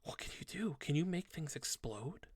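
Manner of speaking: put-on soft, hushed kid's question; small, soft voice full of curiosity; awed child. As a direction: ask quietly, like a fascinated small child.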